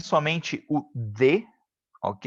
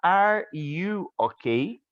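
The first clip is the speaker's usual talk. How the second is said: slow English demo; 4 semitones higher, much slower than usual. Shown as a demonstration. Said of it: "eu pergunto pra senhora Vera".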